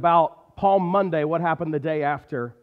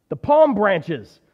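desperate shout, higher and louder; 5 semitones higher, 8 dB louder, slower than usual. A narrator's reading. Donkey shouting at the marketplace crowd, desperate. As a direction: shout it out, searching for the palms. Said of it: "he shouted".

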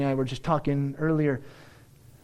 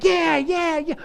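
much higher, louder happy yeah; gleeful David; 12 semitones higher, 6 dB louder than usual. Retold or imitated. David waving off his wife, high and giddy. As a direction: brush it off with glee. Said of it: "but he's like".

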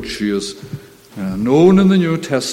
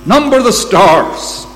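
God commanding; 6 semitones higher, 6 dB louder, slower than usual. Acted. Preacher voices God's command.

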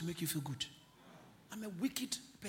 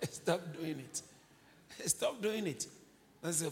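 ordinary talk, nothing marked

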